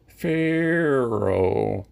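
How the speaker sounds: slow, drawn-out buzzing "pharaoh" call; cicada impression. Shown as a demonstration. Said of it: "do his best cicada impression".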